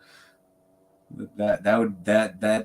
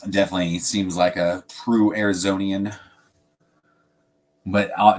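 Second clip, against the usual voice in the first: ordinary delivery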